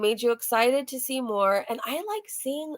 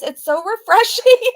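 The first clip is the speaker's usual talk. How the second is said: laughing; 9 semitones higher, 8 dB louder than usual. Real, not acted. Gushing over the haul.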